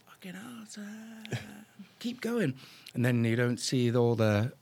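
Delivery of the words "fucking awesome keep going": breathless high whisper; awed whisper. Retold or imitated. Client urging the pilot to keep flying, hushed and thrilled. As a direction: hush it out in awe, then push him on. Client egging on mid-flight.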